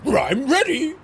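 playful cartoon dog impression; growly R sound at the front, cartoon-dog style; Scooby-Doo. Coaching a viewer's silly seduction act, as a cartoon dog. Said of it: "Scooby-Doo impression and go".

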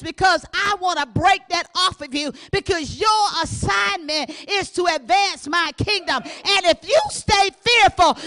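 loud, rising sermon shout, words stretched; full-force preaching. Acted. Preacher shouting at her congregation about fear, full-force preaching.

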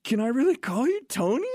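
high, gushing disbelief; starstruck. Retold or imitated. Actor asking the star for first-name terms, mock starstruck.

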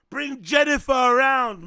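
loud hype. Real, not acted. Loud hyped demand.

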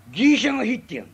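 put-on a drunk resenting the geisha in the next room, loud grumble; loud drunk grievance. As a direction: spit out the complaint. Drunk complains about geisha next door.

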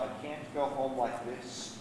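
low, hushed, slow admission; saying to himself. Acted. Gambler alone thinking of home, quietly to himself. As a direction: murmur it slowly, as a private thought. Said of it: "but he said to himself".

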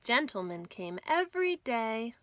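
ordinary delivery, nothing marked

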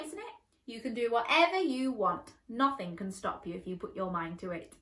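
plain reading voice

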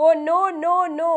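loud frightened cry of no; a child's loud, scared no; screamed. A narrator's reading. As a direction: plead loudly in fear. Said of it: "she screamed".

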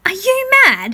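high, loud scared squeal; frightened outburst. A narrator's reading. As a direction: squeal it in panic. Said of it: "Wesley whimpered".

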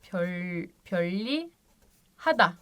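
pöllihada çok yavaş, hece hece; pöllihada'yı ağır ağır oku; slow model. Shown as a demonstration. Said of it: "Ama okurken pöllihada". (yavaş örnek okuma)

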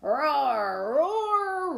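loud, rising playful roar; lion roar. Acted. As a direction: roar big like a lion for kids. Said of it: "lions in the book".

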